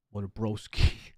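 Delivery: slow, drawn-out New York "broski"; New York bro slang. Shown as a demonstration. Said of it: "that's how we talk".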